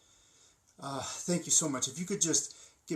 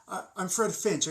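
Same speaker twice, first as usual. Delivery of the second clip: normal talking voice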